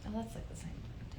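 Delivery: hushed, breathless whisper; whispered under the breath; whispered aside. Real, not acted. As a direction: drop to a whisper, sharing a secret. Whispered aside mid-rant.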